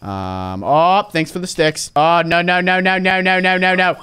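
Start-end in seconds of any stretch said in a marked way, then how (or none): none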